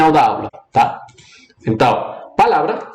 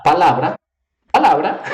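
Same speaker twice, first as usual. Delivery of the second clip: "palavra" with a lifted, pushed "la"; stress on LA; 2 semitones higher than usual. Shown as a demonstration. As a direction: lean on the "la" of "palavra". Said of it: "é em la palavra". ("palavra" com o "la" destacado)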